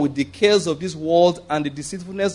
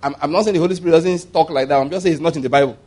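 ordinary talk

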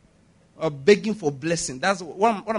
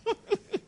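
short, high, soft laugh; amused laugh; 6 dB quieter than usual. Real, not acted. Preacher amused at praising God for twenty naira, amused laugh. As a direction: let out a small high chuckle.

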